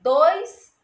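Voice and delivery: Dois said loud and high; emphatic Dois; stress on Dois. Shown as a demonstration. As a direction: punch Dois to stress two S's. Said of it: "dois S's".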